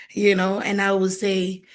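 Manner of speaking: usual speaking voice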